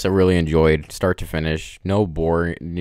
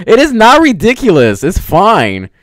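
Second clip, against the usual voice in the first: higher, louder firm denial; insistent protest; 11 semitones higher, 9 dB louder than usual. Real, not acted. Vlogger defending the movie ending to Ernest, loud insistent protest. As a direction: put your foot down, raising the voice.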